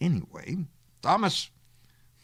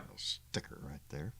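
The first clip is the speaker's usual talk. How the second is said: hushed unvoiced aside; whispering; 10 semitones lower, 15 dB quieter than usual. Real, not acted. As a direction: whisper it off to the side. Quiet aside at camera.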